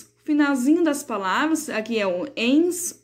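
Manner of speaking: ordinary talk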